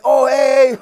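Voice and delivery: high, loud, giggly imitation of the girls; eager mock greeting. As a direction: play the girls running back all sweet and eager. Girls come running back, eager.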